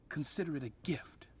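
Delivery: quiet. Acted. Sinister offer to hitman.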